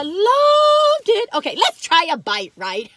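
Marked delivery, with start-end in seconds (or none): 0.0-1.3 high, loud and slowly drawn out; rapturous, stretched-out gush. Real, not acted. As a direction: swoon over the memory. Fond memory of Maine.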